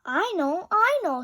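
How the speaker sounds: high, loud and eager; bursting to share the idea. A narrator's reading. Kitten eagerly telling the others her idea.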